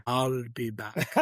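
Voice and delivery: slow, low robotic movie line; Terminator impression. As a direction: deliver it like a cyborg. Picking sci-fi, famous line.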